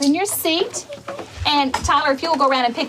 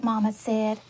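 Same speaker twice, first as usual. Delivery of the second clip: ordinary talk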